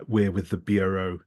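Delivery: usual speaking voice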